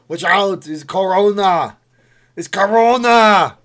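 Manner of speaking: loud, high-pitched Austrian action-star impression; Arnold Schwarzenegger. Streamer warns viewers about Corona, shouted action-star impression. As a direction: bellow warnings in a hammy Austrian accent. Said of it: "when I do an Arnold Schwarzenegger impression".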